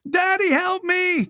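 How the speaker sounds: high, slow, desperate shout; trapped girl crying out; shouting for help. A narrator's reading. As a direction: scream for daddy to save her. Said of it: "Colette shouted".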